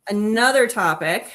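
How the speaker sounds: usual speaking voice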